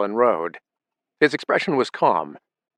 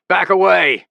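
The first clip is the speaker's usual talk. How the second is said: loud, raised command; bellowing; 8 semitones higher, 6 dB louder than usual. A narrator's reading. Father bellows at sons to back off, loud. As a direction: bark the order. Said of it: "he bellowed".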